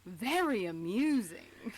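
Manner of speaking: slow, dry villain line; Master's dry drawl. Acted. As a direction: savour each word like a smug villain. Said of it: "I was gonna say".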